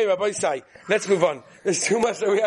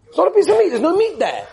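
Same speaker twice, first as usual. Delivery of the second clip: much higher and louder, outraged; indignant shout; 8 semitones higher, 8 dB louder than usual. Retold or imitated. Rabbi mimics the questioner's indignant shout. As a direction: blurt it out as a loud protest.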